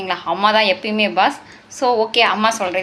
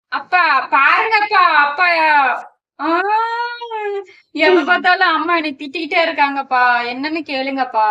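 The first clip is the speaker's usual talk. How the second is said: put-on tattle to dad like a child; whining daughter; 4 semitones higher, 4 dB louder than usual. Daughter complains to father about mother. (அப்பாவிடம் சிணுங்கும் மகள்)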